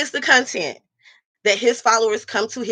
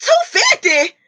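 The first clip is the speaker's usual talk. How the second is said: shocked; 13 semitones higher, 4 dB louder than usual. Real, not acted. Shocked at the hair price.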